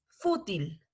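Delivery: "fútil" slowly, stressing the FÚ; stress on first syllable. Shown as a demonstration. Teacher corrects where the accent falls, stressed FÚ in "fútil". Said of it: "hacer la entonación del acento".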